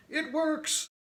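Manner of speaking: high, bright burst of triumph; excited cheer. Acted. Dad cheering at the tractor, high excited whoop. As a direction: cheer as the old tractor finally runs.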